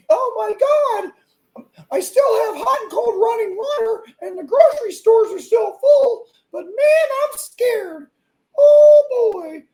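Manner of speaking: squeaky, very high, loud crying whine; mock the scared in a shrill cartoon mouse voice; mock Mickey Mouse. Ranting host mocks scared people as cartoon mouse, squeaky loud whine. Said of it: "please never try to do a Mickey Mouse impersonation".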